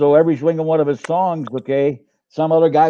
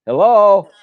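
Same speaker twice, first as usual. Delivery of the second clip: loud, raised-pitch check-in; calling out; 6 semitones higher, 6 dB louder than usual. Real, not acted. Dad calling to his blanked-out co-host, calling out. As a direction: call out to see who's there.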